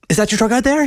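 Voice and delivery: high, nervous blurt; one long nervous sound; nervous rush. Acted. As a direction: say it all in one breath, high. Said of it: "It came out in one long sound".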